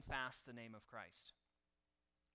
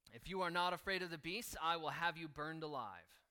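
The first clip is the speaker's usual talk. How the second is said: loud, higher menacing threat; threatening governor; 4 semitones higher, 7 dB louder than usual. A narrator's reading. Governor threatens Polycarp with burning, loud and menacing. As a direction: lean in and threaten him with fire.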